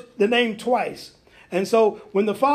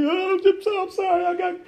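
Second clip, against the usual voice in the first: much higher, hammed-up tearful pleading; mock weeping plea; 9 semitones higher than usual. Sinner at the altar begging God, mock tearful high plea. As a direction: whine for forgiveness like a sobbing sinner. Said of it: "we crying and weeping".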